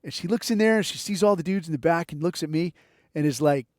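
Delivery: ordinary delivery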